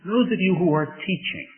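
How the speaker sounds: ordinary talk, nothing marked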